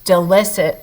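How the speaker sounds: plain reading voice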